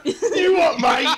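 hammed-up London accent; Cockney Shadow. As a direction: shout it like a Cockney lad. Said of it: "I want every time I play a Shadow game to be like".

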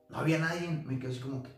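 ordinary delivery

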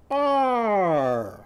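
high strained Ar! as if hanging by one arm; straining grunt. Shown as a demonstration. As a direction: grunt Ar! pulling down on the bar. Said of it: "and go".

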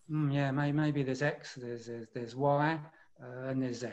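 unsure, stumbling over repeated words; hesitant. Shown as a demonstration. Coach showing meeting leaders how not to sound, hesitant. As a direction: hedge with mm and uh, softly. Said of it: "It's not".

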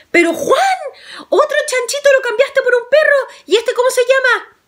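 abuela aguda y fuerte; regaña como abuelita, agudo; abuela regañona. A narrator's reading. (la abuela reprocha a Juan, voz aguda de anciana)

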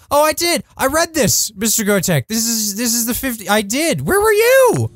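high-pitched, indignant outburst; squawked, playful defensiveness; excited protest. Real, not acted. Protests he did read the message.

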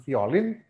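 "violin" misread as "fi-o-lin", rising; wrong "violin". Shown as a demonstration. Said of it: "bukan violin".

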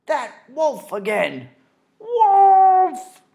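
very high, very loud alarmed yell; alarmed shout. Acted. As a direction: scream the warning across the field. Betsy spots the wolf, shouts.

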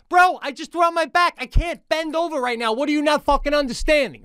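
fed up with the doctor, voice pushed high; exasperated rant. Retold or imitated. Frustrated retelling to the doctor.

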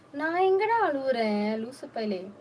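high, loud teasing denial; affronted comeback to a friend; indignant protest. Real, not acted. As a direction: protest loudly, pitch shooting up. Protests a claim of crying.

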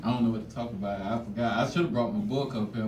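ordinary talk

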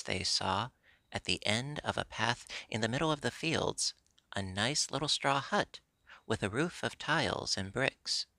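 ordinary delivery, nothing marked

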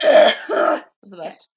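loud, slow, put-on voice; drawn-out brag. Real, not acted. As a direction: stretch it out proudly. Joking brag.

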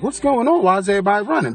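high-pitched mock bewilderment; clueless driver. Driver sitting there as everybody runs, mock clueless. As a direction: mimic someone with no idea what happened.

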